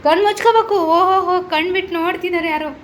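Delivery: high, loud playful scolding of a peeker; playful teasing. Real, not acted. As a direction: tease him for opening his eyes. Playful teasing child peeking. (ಆಟವಾಡುತ್ತಾ ಮಗನನ್ನು ರೇಗಿಸುವುದು)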